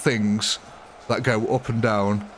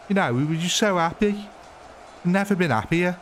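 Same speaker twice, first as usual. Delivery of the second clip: high, hammed-up Brummie; put-on higher Birmingham voice; Birmingham accent; 7 semitones higher than usual. Shown as a demonstration. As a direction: keep up a comic Birmingham accent, pitched high. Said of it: "you could say that in a Birmingham accent".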